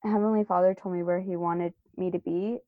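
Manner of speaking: ordinary talk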